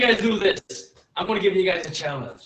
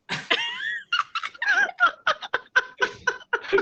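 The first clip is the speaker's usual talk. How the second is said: high burst of laughter. Real, not acted. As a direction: break up laughing. Loud laughter after impression.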